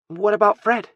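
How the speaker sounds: put-on high, worried child's question; little boy. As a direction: ask as a small boy about a toy. Small boy asks after bear.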